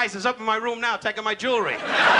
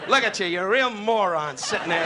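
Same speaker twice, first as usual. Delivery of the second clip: ordinary talk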